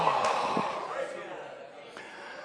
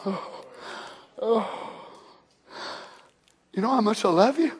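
softer, gentle loving question; tender whisper-soft; 10 dB quieter than usual. Acted. God asking his people, gentle and much softer. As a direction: ask it like a father cradling a child.

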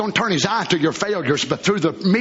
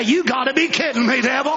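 defiant scoff; 8 semitones higher than usual. Acted. Shouting back at the devil.

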